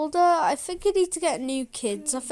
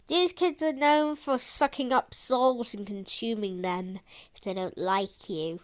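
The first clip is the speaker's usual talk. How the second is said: ordinary talk, nothing marked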